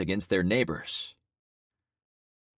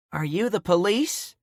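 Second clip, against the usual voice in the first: Ghost Woman's question; 13 semitones higher, 4 dB louder than usual. Acted. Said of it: "Ghost Woman asked".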